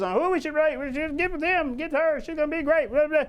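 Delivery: high-pitched, run-together imitation of eager brainstorming; mock excited chatter. Team throws out ideas on who to get, mock excited. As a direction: mimic a gushing team tossing out ideas.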